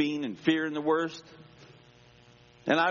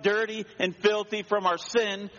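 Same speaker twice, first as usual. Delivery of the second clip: higher, slower sermon voice; preaching; 7 semitones higher than usual. Acted. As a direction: preach it, pressing the point home. Preaching the point at full force.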